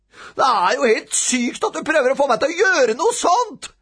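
put-on high, loud, outraged boy; shrieked protest. A narrator's reading. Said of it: "skrek Robin".

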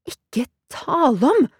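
high, drawn-out protest; indignant refusal. A narrator's reading. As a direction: refuse outright, stretching the words.

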